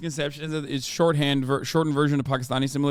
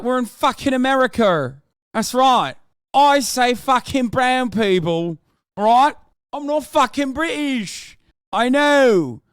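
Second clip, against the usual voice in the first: higher and louder, slow, insistent; loud exasperated rant; 7 semitones higher, 4 dB louder than usual. Real, not acted. Streamer ranting at a viewer about not being British, loud and exasperated. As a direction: hammer home that he's not British.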